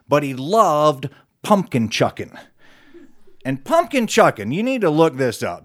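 usual speaking voice

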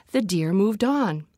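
ordinary delivery, nothing marked

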